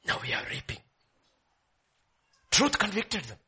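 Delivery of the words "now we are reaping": soft whisper, low; whispered confession. Acted. Brothers whispering their guilt to each other. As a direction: breathe it out in guilt. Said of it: "He says".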